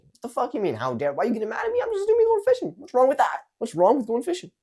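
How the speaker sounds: hammed-up outrage at being called out; acted indignant argument. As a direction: rise up hard, indignant and defending yourself. Acted quarrel over assumption.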